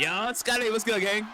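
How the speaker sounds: high, loud hype greeting; trying too hard cool. Real, not acted. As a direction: shout out like a hype man. Streamer greeting chat hyped.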